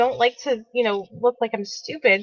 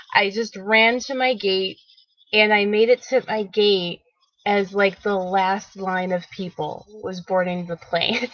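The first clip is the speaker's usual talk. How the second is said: normal talking voice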